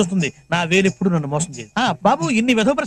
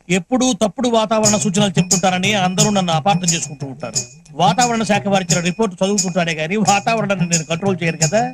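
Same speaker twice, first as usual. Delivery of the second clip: usual speaking voice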